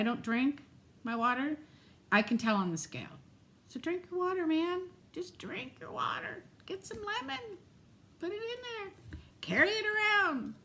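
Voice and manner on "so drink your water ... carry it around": playful urging. Real, not acted. Urging viewers to drink water.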